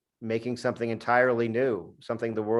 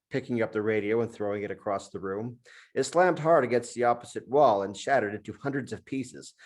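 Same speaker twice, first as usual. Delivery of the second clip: usual speaking voice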